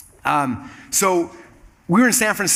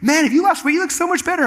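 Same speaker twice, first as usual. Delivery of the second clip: high-pitched, mock-gushing admirers; put-on excited flattery, pitched way up; gushing compliment; 12 semitones higher than usual. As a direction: imitate people fawning in a raised voice. Acting people's admiring compliments.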